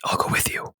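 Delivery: soft, unvoiced whisper. Neldor whispering in Ornan's ear to join him, hushed whisper. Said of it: "he whispers in your ear".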